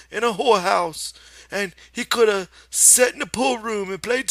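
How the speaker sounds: put-on a stern old aunt wagging her finger; scolding aunt in Lenny's voice. A narrator's reading. Aunt Clara scolds Lenny, very high and loud in his voice. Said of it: "she interrupted him".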